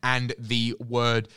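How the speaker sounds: usual speaking voice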